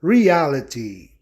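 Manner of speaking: "Reality" said slowly with stress on the A. Shown as a demonstration. Teacher giving an example to students, slow "Reality" stressed on A. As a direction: stretch out "Reality", landing on re-A-lity. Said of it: "para A ser ouvido de forma tônica".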